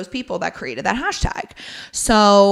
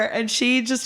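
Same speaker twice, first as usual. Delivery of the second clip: ordinary delivery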